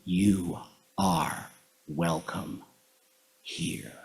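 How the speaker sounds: very soft, slow, low welcome; hushed, tender. Shown as a demonstration. Leader closing the welcome after saying it with love, hushed. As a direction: let it sink to a near whisper.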